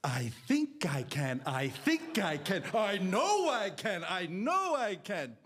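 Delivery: slow chant, climbing in pitch; mom's saying. Retold or imitated. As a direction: imitate mom reciting a storybook refrain. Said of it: "it's like I always say".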